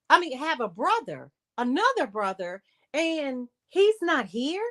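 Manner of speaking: high, pressing indignant questions. Retold or imitated. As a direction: demand to know why he isn't there. Retold indignant questioning of dad.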